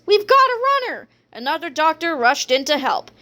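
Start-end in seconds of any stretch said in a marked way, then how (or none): 0.1-1.1 much higher and loud, raising the alarm; shouted alarm. A narrator's reading. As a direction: call it out to the whole ward. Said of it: "shouted the psychologist".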